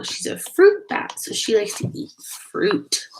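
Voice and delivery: ordinary delivery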